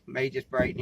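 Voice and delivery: ordinary talk, nothing marked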